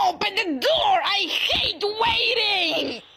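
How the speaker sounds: Shrimpo. Acted. Angry shrimp stuck at a door, impatient mock-raging shout. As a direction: rage impatiently, dragging out the last words. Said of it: "play as this little angry shrimp".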